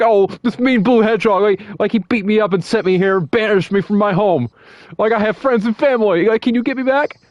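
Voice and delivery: high-pitched mock sob story; fake tears. Eggman playing victim to Knuckles with fake tears. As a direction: put on a tearful, pleading whine. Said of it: "doing, like, fake tears".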